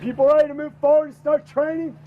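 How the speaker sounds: barked fast question to recruits; drill sergeant. Real, not acted. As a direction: shout it at the new recruits. Instructor shouting at new recruits.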